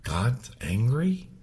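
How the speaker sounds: ordinary talk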